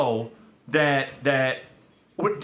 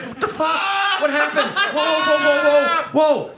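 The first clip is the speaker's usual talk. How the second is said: high, loud startled protest; re-enacted shock, pitched way up; alarmed reaction; 13 semitones higher, 5 dB louder than usual. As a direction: act out backing off in alarm. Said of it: "And I'm like".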